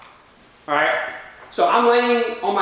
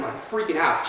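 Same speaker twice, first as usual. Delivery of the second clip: ordinary talk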